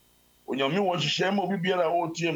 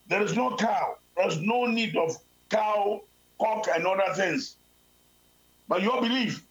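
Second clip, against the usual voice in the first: preaching; 5 semitones higher than usual. Acted. Preacher raising voice to congregation.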